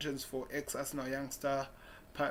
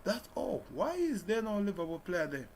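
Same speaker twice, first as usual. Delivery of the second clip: high-pitched, louder disbelief; surprised; 7 semitones higher, 5 dB louder than usual. Real, not acted. Fan questioning the absence of Liverpool players, surprised. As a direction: raise pitch and volume in real surprise. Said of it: "I'm just surprised".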